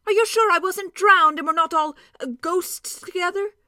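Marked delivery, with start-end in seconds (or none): 2.4-3.5 breathless whispered fear; fearful whisper. A narrator's reading. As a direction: whisper the dreaded word. Dwarf fearing he is dead.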